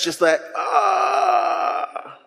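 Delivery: long strained high cry of embarrassment; a slow high wail of shame; mortified groan. Retold or imitated. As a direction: let out a drawn-out mortified ah. Reliving mortified moment chasing kid.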